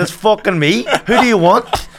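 loud, high exasperated retort; loud exasperated retelling of his reply. Retold or imitated. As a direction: snap back, fed up at being called.

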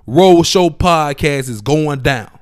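ordinary talk

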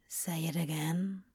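whispered, soft and close. A narrator's reading. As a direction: breathe it as a plea.

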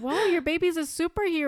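high, loud, playful wonder; amazed exclamation. Retold or imitated. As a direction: imitate a doctor's joking amazement, big and loud. Doctor's amazed joke acted out.

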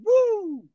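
high, loud whoop; yelled woo. Retold or imitated. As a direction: imitate an excited jumper's "woo". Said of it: "Don't be yelling".

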